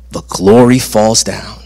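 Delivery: usual speaking voice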